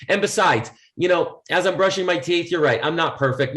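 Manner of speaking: normal talking voice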